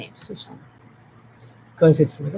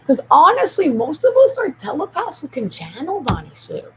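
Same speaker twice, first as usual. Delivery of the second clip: loud grand claim; 8 semitones higher, 9 dB louder than usual. Real, not acted.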